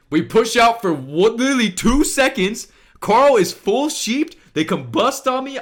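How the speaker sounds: high, loud and emphatic; excited play recap. Real, not acted. Player recounting a sudden attack to viewers, excited.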